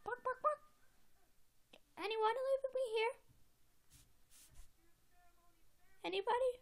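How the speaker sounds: high squeaky little animal voice; left-behind pet. Pretend. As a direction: call out as a tiny abandoned pet. Pet left behind calls for company.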